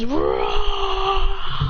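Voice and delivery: imitated giant monster's roar; long drawn-out mock roar. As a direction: roar like a huge beast. Giant monster roars at heroes.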